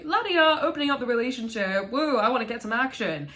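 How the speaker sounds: high sing-song mock breeziness; mock carefree partner; carefree mockery. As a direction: prance through it airily. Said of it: "someone's like".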